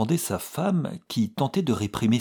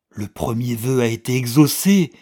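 loud, feverish insistence; 4 semitones higher, 10 dB louder than usual. A narrator's reading. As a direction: press him, burning with hope. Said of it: "fiévreusement". (fiévreux, plus fort)

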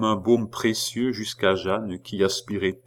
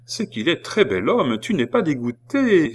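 teasing woman; 7 semitones higher, 4 dB louder than usual. Acted. Last woman to friends.

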